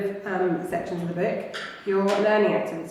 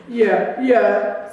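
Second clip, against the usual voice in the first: muffled voice; 2 semitones higher, 4 dB louder than usual. Acted. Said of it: "in a very muffled voice".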